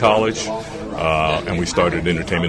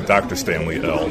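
normal talking voice

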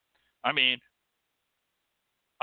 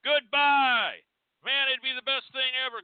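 mocking the critics; 8 semitones higher than usual. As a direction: ham up a smug heckler cheering them off. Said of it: "I see a lot of people going".

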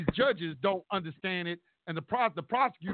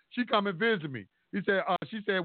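ordinary talk

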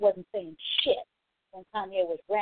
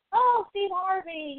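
mock fan swoon; 9 semitones higher, slower than usual. Mocking swooning Family Feud fans.

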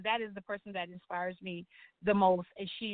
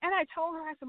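ordinary talk, nothing marked

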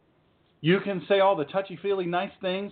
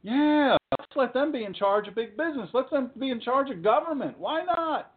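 high-pitched mock enthusiasm; sure-why-not sarcasm, voice climbing; sarcastic agreement; 7 semitones higher than usual. Sarcastically agreeing to students' plea.

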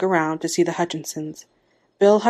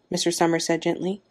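ordinary delivery, nothing marked

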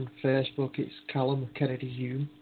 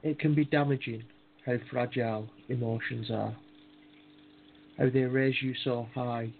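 poem reading. Acted. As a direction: read it like a tender poem. Reads his own poem.

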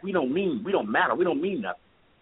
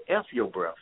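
low, dismissive sneer; cold sneer; 7 semitones lower than usual. Retold or imitated. Officer's reply quoted.

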